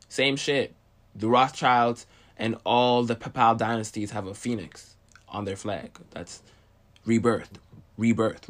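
ordinary talk, nothing marked

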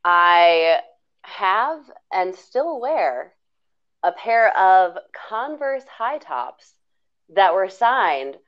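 slow, deliberate, building suspense; slow dramatic reveal. Acted. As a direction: draw out the big reveal.

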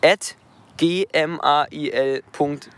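slow, each letter said separately; spelled out letter by letter. Shown as a demonstration. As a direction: spell it out slowly for dictation. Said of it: "Ich buchstabiere sie nochmal".

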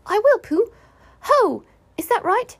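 high, loud, eager little creature; small animal trying out its part; Piglet. Acted. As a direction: squeak it up high, keen to join in.

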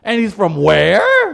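high, loud, drawn-out "WHERE"; hammed-up incredulous shout, stretching "where"; mock disbelief. Acting out fans learning the actor's origins, mock disbelief. Fans' shock acted out again.

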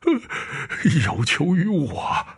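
high, slow, trembling question; shaking near tears. Acted. Frightened painter asking the ghost what it wants, trembling. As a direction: quaver like you're about to cry. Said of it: "抖得话音都像在哭".

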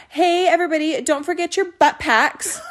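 put-on bright Valley Girl announcement, slowed. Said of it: "I said it like a Valley Girl the first time".

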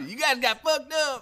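very high mimicked holler; high-pitched, hammed-up yell; Jeff Beach. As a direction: imitate a rowdy friend crowing. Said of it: "that's how he sounds".

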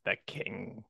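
slow, low, soft villain lion; a hint of sinister Scar. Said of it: "Jeremy Irons as, uh, Scar".

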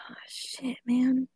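hushed whispered curse. Real, not acted. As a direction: breathe it out silently. Hiding from the killer.

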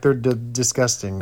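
ordinary talk, nothing marked

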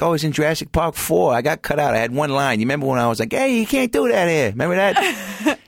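high, put-on Chicago cop voice; Chicago accent. Park cop bluffing kids about movie roles, in a Chicago accent. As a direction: brag in a big Chicago accent, pitch climbing. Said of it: "Chicago accent like this".

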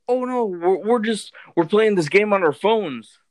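loud, high mock-innocent explaining; imitated eager explanation. Strangers explain themselves outside a house, high loud imitated innocence.

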